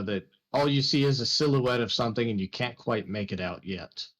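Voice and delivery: normal talking voice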